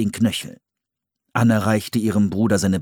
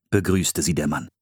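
ordinary delivery, nothing marked

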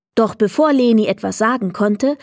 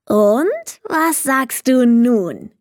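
slow, eager goblin with a grin; a proud goblin fishing for praise, slowly; expectant goblin; 4 semitones higher, much slower than usual. Acted. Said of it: "fragte er erwartungsvoll". (erwartungsvoller Kobold, langsam)

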